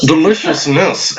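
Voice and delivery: low, slow savoring voice; deep voice. Pretend. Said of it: "I gotta stop".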